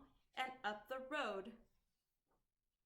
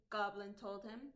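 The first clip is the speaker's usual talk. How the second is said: ordinary delivery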